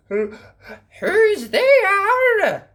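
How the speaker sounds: put-on wolf faking a high, loud old-lady quaver; voice like Grandma's. A narrator's reading. Wolf in bed pretending to be grandma, high quaver. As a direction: imitate granny, quavering. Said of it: "in a voice like Grandma's".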